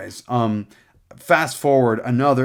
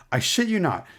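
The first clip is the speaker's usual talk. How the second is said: ordinary delivery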